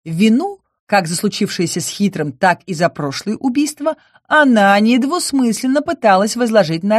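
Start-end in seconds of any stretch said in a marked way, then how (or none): none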